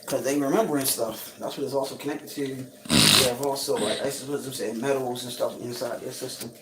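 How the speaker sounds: usual speaking voice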